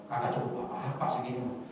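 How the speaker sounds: mock her whiny complaint, understated; complaining wife. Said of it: "ngomongnya gimana?". (meniru istri yang mengeluh pelan)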